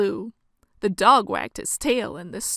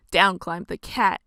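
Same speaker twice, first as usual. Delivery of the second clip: plain reading voice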